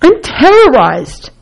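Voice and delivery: high, slow demo of terror; terrorized. Shown as a demonstration. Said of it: "It's a spectrum of intensity".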